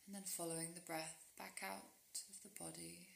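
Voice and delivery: soft, unhurried meditation voice with long pauses; guided sleep meditation. Real, not acted. Guiding a listener into rest with yoga nidra, calm meditation voice.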